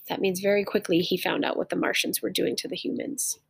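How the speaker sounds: normal talking voice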